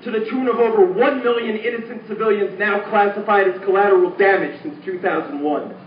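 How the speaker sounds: intense, rhythmic protest poem delivery; spoken word poem. Acted. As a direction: declaim it like a slam poet.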